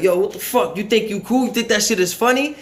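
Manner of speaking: loud, high shove-and-yell; angry challenge. Retold or imitated. Slim confronting the new kid, angry and loud. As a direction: confront him, spoiling for a fight. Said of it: "He's like".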